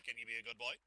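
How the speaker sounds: low, coaxing voice; talking to a dog. Acted. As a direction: coax him like a puppy.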